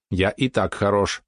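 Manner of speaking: slow, evenly spaced robot speech; robot spider. Acted. Mechanical spider agrees it is fine as is, slow measured robot voice. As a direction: draw each word out like a machine.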